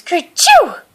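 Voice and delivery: loud, high mock sneeze. A narrator's reading. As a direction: burst out a big high kerchoo. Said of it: "tickled his nose and made him sneeze".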